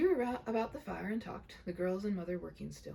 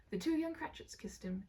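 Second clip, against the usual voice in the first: ordinary delivery, nothing marked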